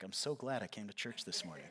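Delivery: low, very soft deadpan; wry aside. Acted. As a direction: mutter it as mock gratitude. Mimics congregation's wry reaction.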